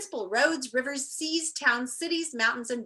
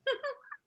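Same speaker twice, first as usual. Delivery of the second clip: short laugh; 6 dB quieter than usual. Real, not acted. Reader laughs at joke.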